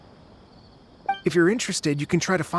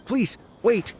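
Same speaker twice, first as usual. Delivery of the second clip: raised call to stop them leaving; 6 semitones higher than usual. Acted. Researcher calls after the departing travelers, raised voice. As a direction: call out before they walk away.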